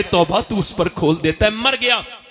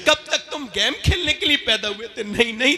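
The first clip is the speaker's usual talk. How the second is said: ordinary talk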